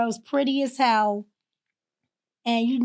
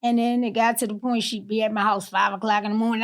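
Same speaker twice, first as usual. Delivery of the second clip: usual speaking voice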